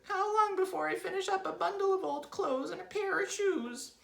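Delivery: very high, loud squeaky wail; Mrs. Twit's desperate cry. A narrator's reading. Wife begging husband for a prognosis, panicked cry. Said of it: "cried Missus Twit".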